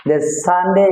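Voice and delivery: ordinary talk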